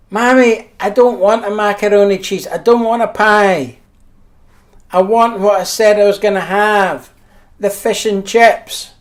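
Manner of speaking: put-on stubborn child refusing mum; whiny kid. Child refusing mother's suggestions.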